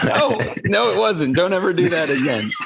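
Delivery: mock horror. Real, not acted. Cohost squealing at the bad accent, laughing.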